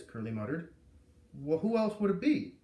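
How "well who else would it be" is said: higher officer voice. A narrator's reading. Officer answering the foreman, higher and louder. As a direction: pitch it up, a little louder, baffled officer.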